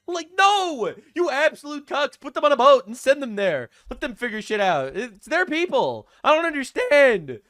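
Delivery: loud, high exasperated outburst; exasperated rant. Acted. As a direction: scold them in comic disbelief.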